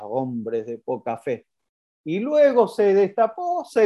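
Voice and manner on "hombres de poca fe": lower, softer and slow; calm, soft rebuke. Retold or imitated. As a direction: gently shake your head at them. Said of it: "Jesús corrió la sábana y dijo".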